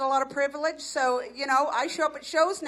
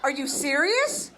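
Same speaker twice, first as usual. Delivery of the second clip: shocked disbelief; 5 semitones higher, 4 dB louder than usual. Retold or imitated. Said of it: "I'm like".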